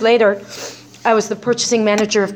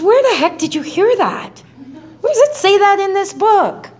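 much higher, mock disbelief; incredulous; 10 semitones higher than usual. Sponsor Bill questioning where she heard that, incredulous. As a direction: toss the question up in surprise. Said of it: "He says".

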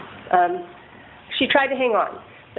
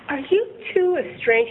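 detective asking; 6 semitones higher than usual. Retold or imitated. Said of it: "And he's like".

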